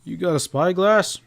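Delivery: a curt, abrupt cowboy question. A narrator's reading. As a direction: snap the question out suddenly. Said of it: "he asked abruptly".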